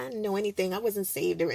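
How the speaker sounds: ordinary talk, nothing marked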